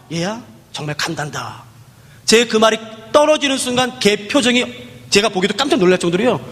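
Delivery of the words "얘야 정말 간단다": much softer, slow, tender reply; soft reassurance. Retold or imitated. Preacher promises the girl heaven, soft and tender. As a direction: promise it gently to a child.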